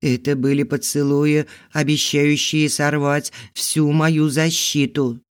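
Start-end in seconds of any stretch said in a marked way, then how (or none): none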